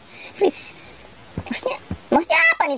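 quick, high, breathy whisper; puzzled whisper. Real, not acted. Gamer baffled by an English instruction, fast puzzled whisper. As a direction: whisper it like you're lost.

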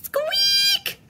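very high little squeak; sudden high-pitched peep; tiny squeak. Acted. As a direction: pipe it up like a tiny creature. Voicing the story's noise.

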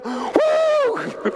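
very high, stretched-out playful ghost woo; mock spooky woo. Preacher teasing the congregation, long high mock ghost woo. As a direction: draw out a silly ghostly woo.

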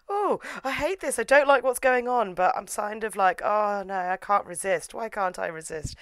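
high, hammed-up mock protest; reluctant swooner. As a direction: whine high, sinking as resistance gives way. Mimics reluctant lover giving in.

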